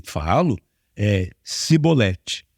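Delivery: slow, low cibolete with an s; fleeing man fails the password, drawn out; mispronouncing. Retold or imitated. Ephraimite answering the guards: slow, low cibolete. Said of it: "Não conseguia pronunciar".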